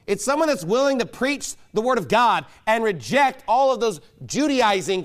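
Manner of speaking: fiery preaching. Acted. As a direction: hammer the point home from the pulpit. Preaching hard against Judaizers.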